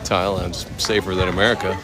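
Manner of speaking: usual speaking voice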